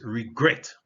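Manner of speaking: REGRET with weak re and punched GRET; second-syllable stress. Shown as a demonstration. Teacher repeats the stress point, REGRET punched on GRET. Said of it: "The first syllable is not stressed".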